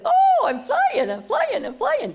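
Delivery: high, slow, laughing cries of joy; baby eagle thrilled. Acted. Baby eagle realizing it can fly, high joyful laughing cry. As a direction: squeal it like a chick discovering flight.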